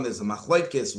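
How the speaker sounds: normal talking voice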